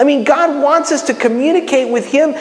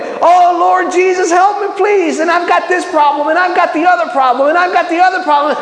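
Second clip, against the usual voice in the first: mock frantic pleading; 7 semitones higher than usual. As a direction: parody someone badgering God, speeding up. Mimics people talking at God.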